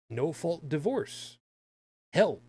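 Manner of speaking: normal talking voice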